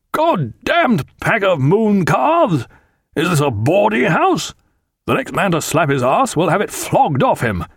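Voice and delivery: high, forceful captain's bellow; angry roar. A narrator's reading. Jack berating his rowdy crew, roaring. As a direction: keep roaring the rebuke across the deck.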